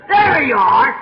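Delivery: loud, clipped-free gotcha shout; triumphant challenge. Retold or imitated. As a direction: fling it at the preacher. Man repeats his challenge.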